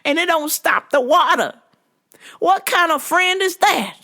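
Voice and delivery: put-on drunken church lady. Customer's high-pitched drunken rant.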